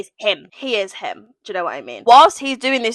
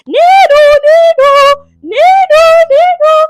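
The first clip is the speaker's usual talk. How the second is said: loud, very high nee-naw siren; imitated siren wail; 12 dB louder, slower than usual. Reader sounding the alarm at the first kiss, siren noise.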